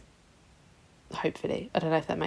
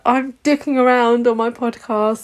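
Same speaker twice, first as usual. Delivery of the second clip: higher, louder mock despair; self-mocking wail; 5 semitones higher, 4 dB louder than usual. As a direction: wail at yourself, half joking. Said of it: "And I was like".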